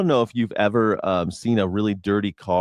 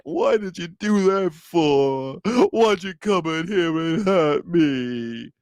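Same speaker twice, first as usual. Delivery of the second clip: slow, higher whimpering complaint; mock crying lion; 5 semitones higher, slower than usual. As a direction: whine like a hurt bully. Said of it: "starts crying".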